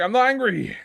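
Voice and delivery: high, loud "not angry" protest; loud, high-pitched indignation; indignant denial. Real, not acted. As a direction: yell that you're not angry. Denying anger to chat.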